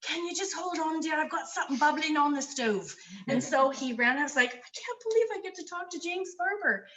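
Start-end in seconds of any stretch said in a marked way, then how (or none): 0.0-2.9 lightly imitated English accent, pitched higher; James Barber's English accent. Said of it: "in his English accent". 4.5-6.9 retold thrill, pitched high; starstruck excitement. Acting out her starstruck reaction.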